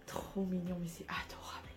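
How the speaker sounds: soft awed whisper. Real, not acted. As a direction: whisper it, charmed. Cooing over cute item.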